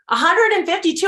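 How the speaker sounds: high, loud disbelief; astonished echo. Retold or imitated. Neighbor stunned by the beanie count, astonished echo.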